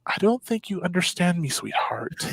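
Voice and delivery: put-on soft, firm older lady; gently insist, trailing to a murmur; sweet but unbending nurse; gentle but firm. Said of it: "she's very gentle with me".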